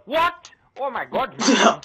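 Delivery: high, loud disbelieving cry; shocked yell. Real, not acted. Getting first blood in the match, high loud shocked yell. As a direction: shriek in surprise.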